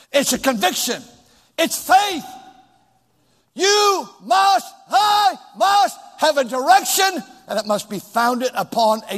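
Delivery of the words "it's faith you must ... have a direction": high, hammered-out words; forceful preaching. Real, not acted. Preacher exhorting congregation on direction, forceful high preaching. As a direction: ring out each word with conviction.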